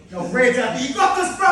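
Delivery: high, loud pumped-up call; mock excited encouragement; hyped up. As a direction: fire it up like a hype man. Retold coach hype exchange.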